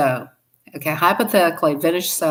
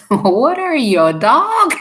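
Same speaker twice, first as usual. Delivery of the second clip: God's teasing voice; 7 semitones higher, 5 dB louder, slower than usual. Retold or imitated. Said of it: "And he says".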